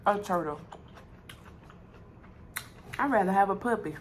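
talking with food in mouth; chewing while talking. Real, not acted. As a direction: mouth partly full. Seen 2: Took forkful at -2; jaw working, talking around food throughout.

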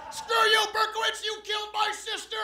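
high, shouted heckle from the crowd; angry heckler. Acted. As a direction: yell it in hammed-up outrage. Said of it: "the victims' families out front heckling".

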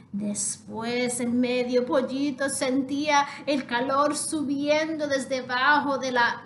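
ordinary delivery, nothing marked

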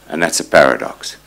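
usual speaking voice

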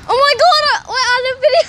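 high, loud sudden squeal of embarrassment; embarrassed shriek. Real, not acted. Vlogger caught off guard by the camera, squealing high and loud. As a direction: yelp as if just caught out on camera.

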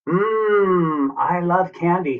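high, savouring "mmm", not whispered; delighted leprechaun. A narrator's reading. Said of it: "he whispers".

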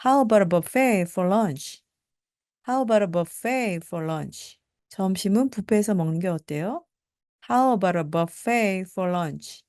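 How stressed, fall at the end; slow, let the end drop; slow question with a falling tune. Shown as a demonstration. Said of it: "끝에 내려주시면 되고요".

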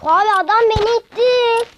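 high, loud complaint; indignant outcry. Real, not acted. Gamer pushed off by another player, loud indignant complaint. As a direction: shout it like you were wronged.

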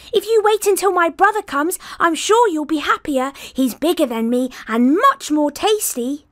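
high wheedling goat voice; coaxing tiny goat. Acted. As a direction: clever little goat talking its way out.

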